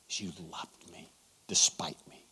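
soft, breathy, tearful whisper. Retold or imitated. Weeping woman thanking the preacher, in a tearful whisper. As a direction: barely get it out through tears. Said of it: "She just began to sob".

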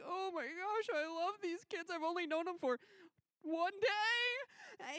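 high, swelling gush, loudest at the end; gushing amazement. Retold or imitated. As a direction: gush with disbelief, pitched well up. Retold sudden love for the kids.

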